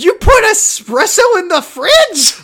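a high, loud, slow cry of disbelief; mock horror at a customer's absurd request; incredulous disbelief. Barista mock-shouting at a customer in high, slow disbelief.

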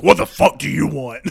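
loud, hammed-up angry growl; mock-furious Santa growl; growled snarl. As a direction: growl it at whoever disturbed you. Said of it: "he just kinda growls".

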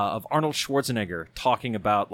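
ordinary talk, nothing marked

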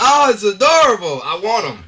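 high, loud gushing over something cute. Real, not acted. Gushing to friends about baby Groot, delighted. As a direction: squeal with delight.

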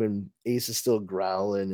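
ordinary talk

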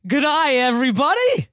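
hammed-up Australian accent. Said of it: "that was my Australian accent".

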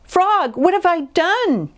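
high, dismayed wail; high-pitched storybook anguish; distressed cry. A narrator's reading. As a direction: cry out in rising alarm at what you caused. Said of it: "cried Toad".

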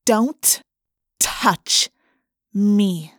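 word-by-word icy threat; cold, controlled menace; voice of cold steel. A narrator's reading. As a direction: separate each word with a hard pause. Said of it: "in a voice of cold steel".

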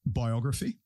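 ordinary talk, nothing marked

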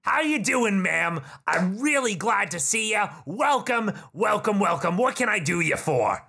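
high, chummy goblin salesman; high, overfriendly goblin voice; eager goblin shopkeeper. Acted. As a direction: gush over the customer like a pushy shopkeeper.

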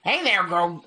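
high squeaky goblin. Acted. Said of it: "Is he a fucking goblin?".

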